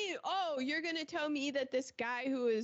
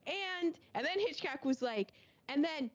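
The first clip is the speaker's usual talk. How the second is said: ordinary talk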